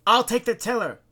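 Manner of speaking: loud, high-pitched call. A narrator's reading. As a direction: shout it over the storm. Volunteering during the rescue.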